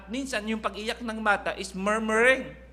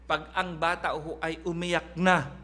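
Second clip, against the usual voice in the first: ordinary talk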